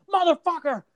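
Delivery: high-pitched imitated movie yell; Van Damme yell. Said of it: "Van Damme yells out".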